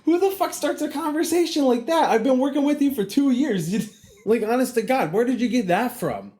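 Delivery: high, incredulous outburst, half laughing; shocked disbelief. Retold or imitated. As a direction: blurt it out in total disbelief. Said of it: "And I was like".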